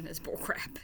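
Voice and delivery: muttered under breath; whispering. Real, not acted. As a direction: whisper it aside. Whispered aside.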